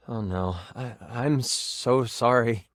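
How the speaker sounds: low, halting regret; sorrowful apology. Real, not acted. Game master breaks bad news to player, low sorrowful apology. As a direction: apologise slowly, sinking low.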